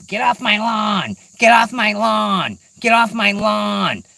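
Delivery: put-on high, drawn-out cranky old-person holler; yelling old-timer. Pine squirrels as old people of the woods, high mock yelling. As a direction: imitate old folks yelling at trespassers, stretching the words. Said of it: "all they do is yell at you".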